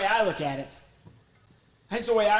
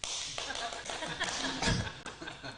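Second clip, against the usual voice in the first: high airy puppet laugh; 8 semitones higher, 7 dB quieter than usual. Acted.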